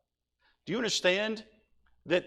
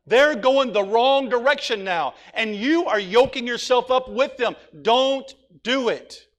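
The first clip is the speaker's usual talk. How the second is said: full-force preaching; 6 semitones higher, 7 dB louder than usual. Real, not acted. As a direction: press the warning home harder and harder. Preacher pleading with young people.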